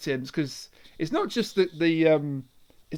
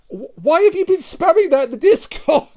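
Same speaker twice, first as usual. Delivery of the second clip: higher, louder exasperated question; mock exasperated; 8 semitones higher, 7 dB louder than usual. Real, not acted. Friend demands why the line was spammed, mock exasperated. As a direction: demand an explanation, half laughing. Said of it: "You need to watch Velocipaster!".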